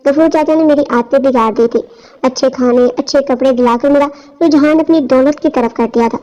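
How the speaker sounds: ordinary delivery, nothing marked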